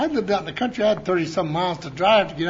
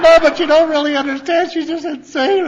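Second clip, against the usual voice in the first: loud pleading; 8 semitones higher, 8 dB louder than usual. Retold or imitated. Retold plea, much louder.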